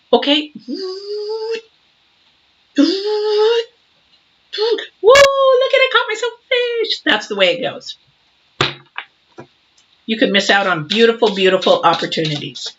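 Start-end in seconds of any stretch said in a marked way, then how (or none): 0.1-7.0 delighted fisherman. Retold or imitated. Acting out a fisherman catching a fish.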